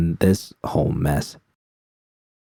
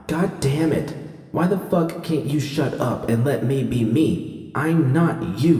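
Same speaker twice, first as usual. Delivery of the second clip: high, strained cry of frustration; anguished outburst; 6 semitones higher, 3 dB quieter than usual. A narrator's reading. Judy snapping at her own inner voice, strained and high. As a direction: lash out at the voice in your head, pitched high.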